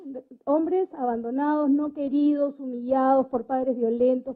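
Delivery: ordinary talk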